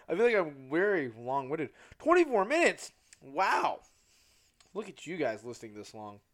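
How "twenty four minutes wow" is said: loud, high-pitched amazement; taken aback by the running time; surprised. Real, not acted. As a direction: burst out in surprise. Reacts to recording length.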